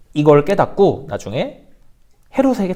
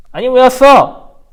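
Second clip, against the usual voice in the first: hammed-up king Herod; 5 semitones higher, 8 dB louder, much slower than usual. Herod answers the visiting magi.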